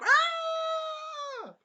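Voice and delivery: very high, loud drawn-out scream; imitated scream. Moriyasu punched away, long high mock scream. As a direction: shriek like someone sent flying. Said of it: "Moriyasu was like".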